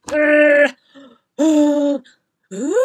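loud drawn-out disgusted uhh; disgusted groan. Shown as a demonstration. Tasting eggshell rice, a loud disgusted groan. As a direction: groan like something awful is in your mouth. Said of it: "something like that".

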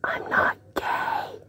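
soft whispered aside; whispering. Real, not acted. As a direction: whisper it under your breath. Quiet asides after wrestling.

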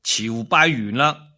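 ordinary delivery, nothing marked

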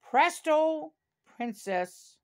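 plain reading voice

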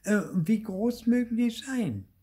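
high, eager wondering; excited exclaim. A narrator's reading. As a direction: gush over the melons. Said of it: "war begeistert und rief aus".

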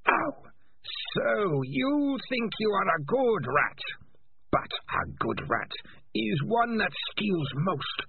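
raging. A narrator's reading. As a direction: spit the accusations at the traitor. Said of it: "The voice raged in Morris's mind".

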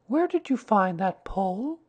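put-on high, questioning boy's voice; Christopher Robin. A narrator's reading. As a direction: play a child asking intently.

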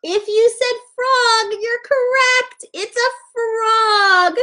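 put-on high-pitched, thrilled kids' teacher; playful squeal of discovery; excited reveal. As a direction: announce it high, stretching the last words. Excited reveal for young children.

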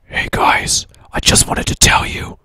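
loud stage whisper; leaning in to whisper. Pretend. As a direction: whisper it, but loudly. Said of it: "your whisper voice is so loud".

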